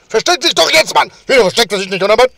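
loud, high exasperated yelling; frustrated shouting. Real, not acted. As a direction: shout at the stubborn character.

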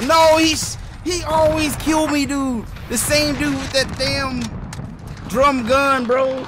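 high, loud annoyed complaint; frustrated rant. Real, not acted. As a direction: vent at the same guy. Killed again, ranting in frustration.

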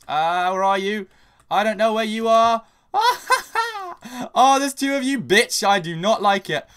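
panicked squealing. Real, not acted. Enemy players sneaking up nearby.

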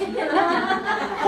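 high bursting laugh. Real, not acted. Laughing at his own college joke, high bursting laugh. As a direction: break into real laughter.

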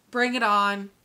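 ordinary talk, nothing marked

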